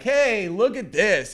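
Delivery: high, drawn-out attention-seeking; mock show-off. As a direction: imitate a kid begging to be watched. Mocks kids wanting attention.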